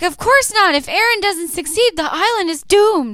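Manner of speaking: high, loud hammed-up Armin; bad acting. Armin overacts sincerity to the soldiers. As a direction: overplay the earnest line. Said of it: "Armin's like".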